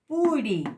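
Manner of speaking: "Puri" said high and loud with a Spanish-style p; plain p model. Shown as a demonstration. Teacher modelling the plain p. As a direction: model "Puri" with a strong lift. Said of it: "La P, como en castellano".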